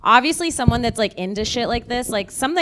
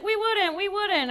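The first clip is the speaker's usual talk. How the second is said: high mock chorus; 12 semitones higher, 3 dB quieter than usual. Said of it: "everybody's like".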